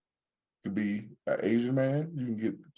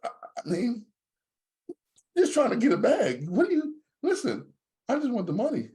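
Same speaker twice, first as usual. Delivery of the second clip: defensive; 12 semitones higher than usual. Real, not acted. Defensive about endorsement.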